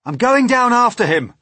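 high, loud, determined cry; James crying out. A narrator's reading. Boy going after fallen centipede, urgent cry. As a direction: call it out urgently. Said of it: "cried James".

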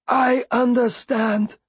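high, slow, sad acceptance; sorrowful. A narrator's reading. Beloved accepts Bee's refusal to touch, sorrowfully. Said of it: "Beloved said sorrowfully".

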